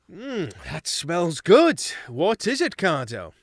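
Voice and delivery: captain humouring his cooks; polite captain. Acted. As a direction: fake enthusiasm while holding back a laugh. Luke pretends to enjoy smell.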